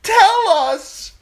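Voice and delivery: very high, loud panicked questions; frightened guests demanding news; frantic shouting. A narrator's reading. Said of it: "someone else shouted".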